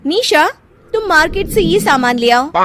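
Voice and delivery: high, bossy order; bossy sister-in-law. Acted. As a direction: boss her around.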